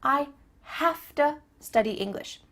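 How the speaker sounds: deliberate "hafta" demo; reduced have to. Shown as a demonstration. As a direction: teach "have to" as "hafta", word by word. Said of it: "to changes to a".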